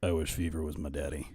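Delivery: low, hushed imitation; low impression. Lowered voice imitation of Ghost.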